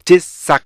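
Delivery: "디스아" in three loud, high, separate beats; stop-sound syllables. Shown as a demonstration. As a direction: punch tit, sak, at with gaps. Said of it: "이렇게 돼 있죠". ("디스아"를 세 음절로 세게 끊어서)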